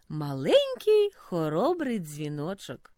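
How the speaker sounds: ordinary delivery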